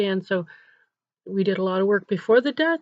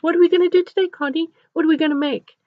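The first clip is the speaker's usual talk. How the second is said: put-on high, louder child's questions; eager little girl; 9 semitones higher, 5 dB louder, faster than usual. Little girl just woken asks her helper, eager. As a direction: imitate a child raring to get started.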